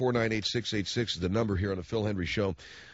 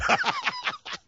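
high laugh. Real, not acted. Laughing at own Craig joke.

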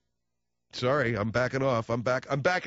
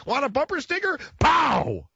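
high eager intern yelling, then a loud gunshot pow; cheery shout cut off; 13 semitones higher, 5 dB louder than usual. Retold or imitated. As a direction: shout the offer, blast the pow. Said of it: "because he's like".

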